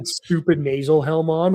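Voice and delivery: ordinary talk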